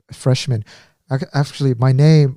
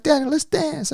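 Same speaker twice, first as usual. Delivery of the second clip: high-pitched playful invitation; light, high and eager; carefree friend; 13 semitones higher than usual. Retold or imitated. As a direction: imitate a free-spirited friend coaxing someone to dance. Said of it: "like, so free".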